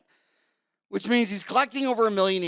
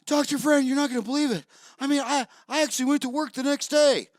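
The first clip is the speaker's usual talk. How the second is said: higher, excited voice of an amazed patient; patient bragging to the dentist, voiced higher and excited; 8 semitones higher, faster than usual. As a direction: gush like a patient amazed at no pain.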